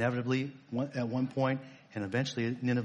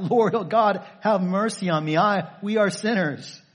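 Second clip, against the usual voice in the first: a raised, pleading cry to God, louder; 6 semitones higher, 6 dB louder than usual. Acted. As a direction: cry out for mercy, begging. Voicing the people's cry for mercy.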